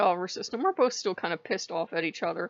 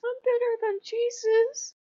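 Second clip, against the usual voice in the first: very high, thin mock-amazed question; flattered surprise. Odin pausing mid-fight at the compliment, mimicked high-pitched wonder. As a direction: imitate someone tickled by unexpected praise. Mimics Odin's reaction to being praised.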